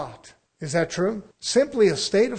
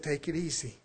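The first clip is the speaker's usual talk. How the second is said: low, soft, easygoing reassurance; Paul Stone; 6 semitones lower, 7 dB quieter than usual. Retold or imitated. Coworker calming the panicked boss, soft and easy. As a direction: imitate someone quietly unbothered. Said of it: "But he said".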